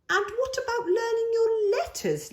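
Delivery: a hint of a patient big brother; coaxing big brother. A narrator's reading. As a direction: gently coax your little sister. Charlie coaxing Lola.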